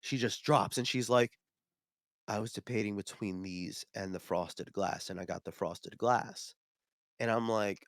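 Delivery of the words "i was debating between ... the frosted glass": low, soft, crestfallen voice; deflated customer. Retold or imitated. Customer regretting her dice purchase, quietly deflated. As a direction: let it sink, quietly disappointed.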